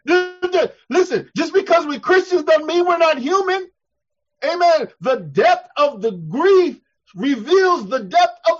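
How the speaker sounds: high, loud, impassioned sermon; full-force preaching. Real, not acted. Preacher defending grief to his congregation, full-force preaching. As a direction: preach it hard, driving each point home.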